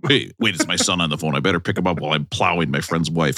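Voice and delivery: low muttered wrestler excuse mid-skit; Hulk Hogan. Acted. Hogan skit, aside before phone call.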